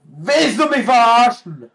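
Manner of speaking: high, loud squawk of disbelief; indignant loud yell; outraged shout. Real, not acted. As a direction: scream at the game in outrage. Outraged at game.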